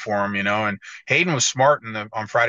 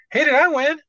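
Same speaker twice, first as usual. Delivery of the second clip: much higher voice, louder, asking innocently; Hunter impression; 5 dB louder than usual. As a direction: squeak it out like the winner doesn't know. Quoting Hunter asking truck driver.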